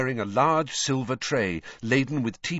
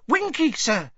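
high-pitched house-elf voice; Dobby, squeaky elf; 14 semitones higher, 4 dB louder than usual. A narrator's reading. House-elf pointing out his friend to Harry, high squeak. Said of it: "Dobby stopped in front".

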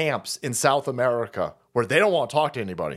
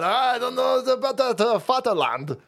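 mock German voice, higher; a hammy German grumble about the homeland; 8 semitones higher than usual. Sitting in the chat, grumbling as a German. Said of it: "I'm sitting there going".

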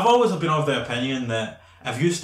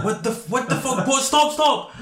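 panicked bystander yelling at the attacker; alarmed, building to a shout; shocked outcry; 11 semitones higher than usual. Retold or imitated. Bystanders react to punched kids.

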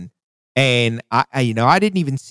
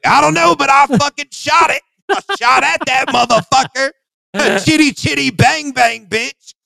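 much higher, excited mock brag; hyped shooter; 14 semitones higher than usual. Friend mocks the shooter's excited brag after the shot. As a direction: ham up the cocky shooter. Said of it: "You're like".

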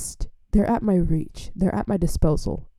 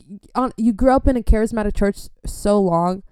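normal talking voice

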